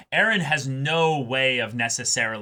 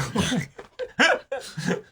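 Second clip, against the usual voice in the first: high laugh; 11 semitones higher than usual. Real, not acted. Laughing at own joke.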